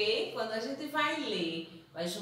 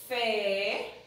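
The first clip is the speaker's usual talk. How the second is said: normal talking voice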